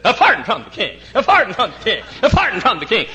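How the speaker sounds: high, loud theatrical proclamation; operetta performer. Retold or imitated. Operetta man in knickers announcing a pardon, mock theatrical cry. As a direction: imitate a stage herald, each repeat the same tune. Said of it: "got up out in the middle of the operetta and started".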